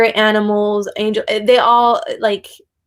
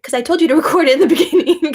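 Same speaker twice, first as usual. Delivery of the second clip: fast, laughing explanation; giggling while owning it; 6 semitones higher, 4 dB louder, faster than usual. Real, not acted. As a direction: laugh bubbling through the line. Laugh heard.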